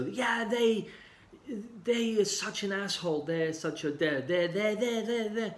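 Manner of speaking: high, strained voice of a client blaming someone else. Retold or imitated. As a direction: play the client who blames everyone, pitched well up. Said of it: "Um, they blame".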